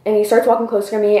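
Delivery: ordinary delivery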